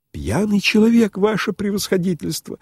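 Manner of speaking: lightly voiced anxious prompt, pitched high; prompting aside. A narrator's reading. Host excusing a drunk guest to His Excellency, prompting. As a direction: offer a high, hasty excuse. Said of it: "снова было подсказал Псилдонимов".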